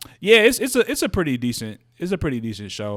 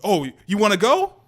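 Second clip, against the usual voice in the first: imitating a raised, louder challenge; mock-confront a friend who misread the text; aggressive; 9 semitones higher, 6 dB louder than usual. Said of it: "he think he being aggressive".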